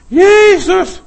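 high, loud shout; scaring the devil. Shown as a demonstration. Preacher frightening the devil with the name, shout. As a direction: bellow the name to scare off the devil. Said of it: "Ich erschreck den Teufel mit dem Namen Jesus".

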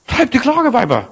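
mock the wailing women with a high voice; mocking high pitch. Shown as a demonstration. Preacher mocking wailing women with a high voice. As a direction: jump the voice high up on "Treib die Klageweiber". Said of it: "Weißt du, was das auf Deutsch heißt?".

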